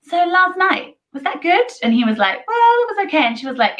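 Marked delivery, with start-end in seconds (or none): 0.0-1.6 higher, light teasing question; high mock girlfriend voice. She voices woman asking partner. 2.5-3.2 higher, offhand shrug of a reply; mock boyfriend reply. Boyfriend shrugging off the question, higher and offhand. He voices boyfriend's lukewarm answer.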